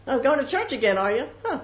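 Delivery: acted sneering dig; mocking taunt. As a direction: needle them like a scoffing acquaintance. They start making fun of you.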